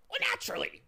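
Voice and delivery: very high, loud cackle of words; cackling bird. A narrator's reading. Bird answering Tock, high cackling voice. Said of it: "he cackled".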